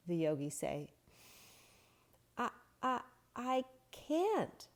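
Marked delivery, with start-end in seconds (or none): 2.4-4.7 high, strained wolf voice gasping for air; breathless wolf. Acted. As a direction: sound like a wolf who can't catch his breath. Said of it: "said the breathless wolf".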